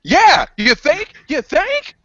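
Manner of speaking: mock him, dripping sarcasm; sarcastic jab at a teammate.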